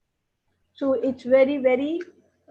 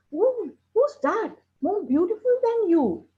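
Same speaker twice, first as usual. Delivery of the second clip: surprised crow voice; 9 semitones higher than usual. Acted. Crow asks the parrot who is lovelier, high surprised voice. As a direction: crow gasping at the news, pitched up.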